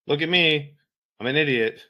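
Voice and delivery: loud, high mock announcement; self-mockery. Soldier mocking his own bare uniform, loud self-mockery. Mocks himself in unmarked uniform.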